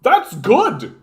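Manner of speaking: high, fast, dim-witted mock voice; airheaded parody of a boomer voter. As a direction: ridicule the naive voter.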